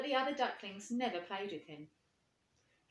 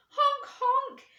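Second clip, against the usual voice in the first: very high, loud joyful honks; excited honk; 13 dB louder than usual. Acted. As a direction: burst out with joy in flight. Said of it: "he cried in excitement".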